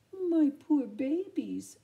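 slow, high doting coo. Acted. Aunt Sarah cooing to her cats, high and slow. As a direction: coo over your pets like babies. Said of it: "she cooed".

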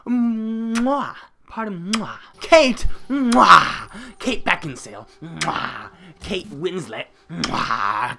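high, hammed-up "mwah" wails; mock wailing cries. Player crying out for Kate to help, mock wailing. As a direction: wail the names like a fake sobber.

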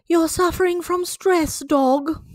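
a new doctor addressing Doctor Dog; the doctor's voice. Acted. Dog's own doctor speaks.

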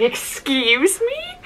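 high, drawn-out mock disbelief; incredulous. Reacting to hearing about her YouTube channel, incredulous. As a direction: stretch it into a rising question. Retells disbelief at celebrity's words.

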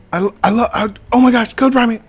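high, excited fan gushing; starstruck fan. Retold or imitated. Fan spotting the YouTuber in game, high excited gushing. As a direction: squeal like a fan meeting his idol. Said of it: "he's like".

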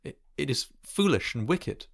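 high, hesitant protest; faltering. A narrator's reading. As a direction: falter on the first word. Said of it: "he faltered".

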